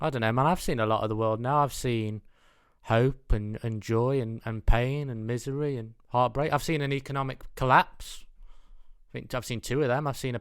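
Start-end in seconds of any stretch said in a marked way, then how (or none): none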